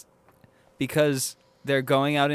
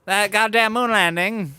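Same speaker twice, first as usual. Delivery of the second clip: put-on mid-Atlantic accent; 7 semitones higher, 5 dB louder than usual. Continuing the mid-Atlantic impression.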